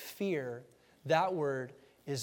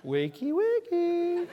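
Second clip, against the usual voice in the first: slow, high sing-song wakey wakey with a laugh; mock gentle wake-up; 13 semitones higher, 3 dB louder, much slower than usual. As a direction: tease a sleeper awake. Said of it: "rubbed his back".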